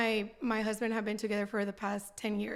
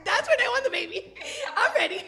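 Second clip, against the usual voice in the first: high, loud and laughing through the words; laughing hard while quoting herself; laughing declaration; 12 semitones higher, 7 dB louder than usual. Retold or imitated. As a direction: giggle it out, giddy with the memory. Laughing retelling of her declaration.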